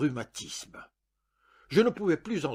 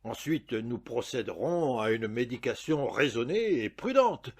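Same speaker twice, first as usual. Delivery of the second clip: plain reading voice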